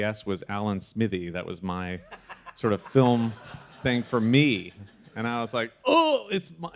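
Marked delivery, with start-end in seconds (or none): 5.8-6.7 a high, loud burst of disbelief; suddenly the penny drops, thrilled; excited realization. Retold or imitated. Retelling the moment he learns his own voice won, excited. Realizing George picked him.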